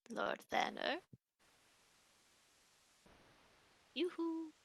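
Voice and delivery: put-on high, soft old lady call. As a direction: coo it like a little granny. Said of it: "I am using the old lady voice".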